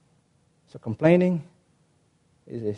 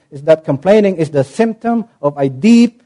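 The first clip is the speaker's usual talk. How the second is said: usual speaking voice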